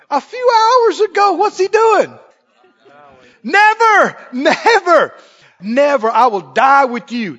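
crying out high and loud, near tears; impassioned boast. Retold or imitated. Scene: preacher voices Peter swearing loyalty, a tearful high shout. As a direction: shout it like a vow you'll break.

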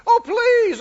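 high, loud mock pleading; a hammed-up desperate plea; begging mode. Preacher mimics frantic believers, loud high begging. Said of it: "gone into begging mode".

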